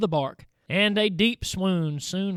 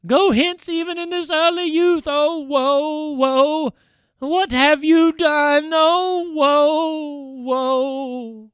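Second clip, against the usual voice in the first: high, loud lament building to wailing; grieving farewell; 6 semitones higher, 7 dB louder, slower than usual. A narrator's reading. Undine bidding her husband farewell, wailing. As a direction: cry out a heartbroken farewell. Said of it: "wearied out".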